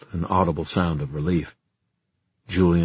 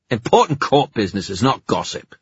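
louder snarling retort; 5 semitones higher, 5 dB louder than usual. Acted. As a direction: snarl it back in contempt. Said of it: "snarled Erec".

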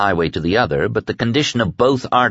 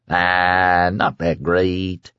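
slow, drawn-out condescension; patronizing; 2 semitones lower, much slower than usual. A narrator's reading. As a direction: drag it out dismissively. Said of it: "the kid said patronizingly".

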